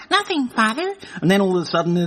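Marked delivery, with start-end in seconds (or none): 0.1-0.9 high, put-on boy's voice; little boy. Timmy answers his father about the phone, mock little boy. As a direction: answer Father as an innocent child. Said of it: "Hey, Timmy".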